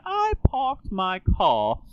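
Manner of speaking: high, very slow British accent, R's dropped. Shown as a demonstration. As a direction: draw it out in British, leaving the R's out. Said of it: "Queen Elizabeth was not saying".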